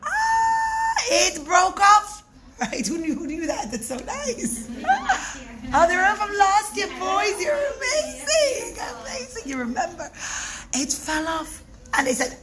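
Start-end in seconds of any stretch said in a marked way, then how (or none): 0.0-2.2 much higher cry, slow and loud; dramatic gasp. Acted. Wheel suddenly breaks. 3.7-9.4 much higher, thrilled and laughing; delighted praise. Real, not acted. Teacher praises children who remembered.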